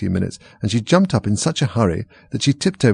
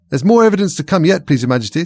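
high, loud urgent interjection; 8 semitones higher, 5 dB louder than usual. A narrator's reading. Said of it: "jumping up in a great hurry".

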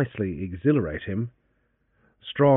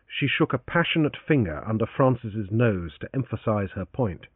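usual speaking voice

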